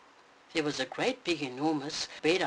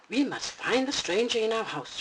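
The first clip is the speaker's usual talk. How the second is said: growling Papa; 6 semitones higher, 4 dB louder than usual. Acted. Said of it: "growled Papa".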